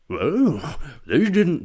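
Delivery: a touch of cheek in the narration; cheeky Attenborough voice. Retold or imitated. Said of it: "when he does the cheeky voice".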